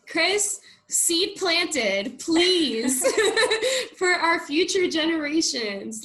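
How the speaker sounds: high, loud, excited appeal breaking into laughter; playful plea. Real, not acted. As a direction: beg cheerfully, laughing through it.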